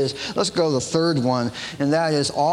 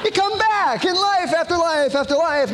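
high, sing-song mocking voice; mock believer; 12 semitones higher than usual. Preacher mocking reincarnation believers, high and sing-song. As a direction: parody the cheery believer.

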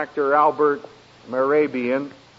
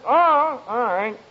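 mock sulky brush-off; 7 semitones higher, slower than usual. Sulking husband brushes off wife.

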